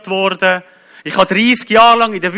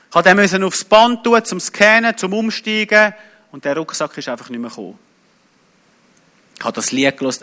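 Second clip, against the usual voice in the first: normal talking voice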